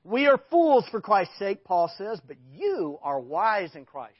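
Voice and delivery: loud, slow, mocking irony; sarcastic. Preacher voicing Paul's scorn at the wise Corinthians, sarcastic. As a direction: lean hard on the irony, slowly. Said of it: "You hear the sarcasm".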